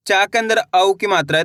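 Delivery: ordinary talk, nothing marked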